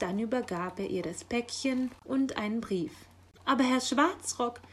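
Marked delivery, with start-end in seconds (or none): 3.4-4.6 raised, louder protesting call; calling after. A narrator's reading. Magpie to the departing raven, calling after him. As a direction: call out after someone leaving. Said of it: "rief sie ihm nach".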